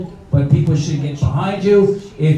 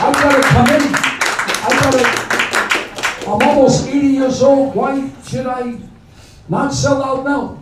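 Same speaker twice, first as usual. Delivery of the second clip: higher, self-pitying parody voice; mock old politician; 9 semitones higher than usual. Host mocks an aging senator's excuses, higher parody voice. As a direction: play the sellout making excuses.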